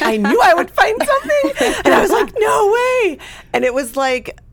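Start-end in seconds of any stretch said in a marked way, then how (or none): 0.0-3.2 high, loud, thrilled retelling; acted-out delighted disbelief, pitch soaring; excited discovery. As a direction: burst out as if you just found it. Acts out discovering the podcast.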